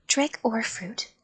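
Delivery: ordinary delivery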